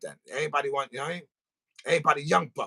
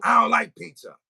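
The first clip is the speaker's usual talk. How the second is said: higher, louder mock refusal; pizza hater; 6 semitones higher, 8 dB louder than usual. Hater caught lying about pizza, high loud mock denial. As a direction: imitate the hater insisting loudly. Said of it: "you got the hater that, that, that calls up".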